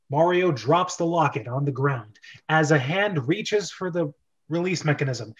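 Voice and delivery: usual speaking voice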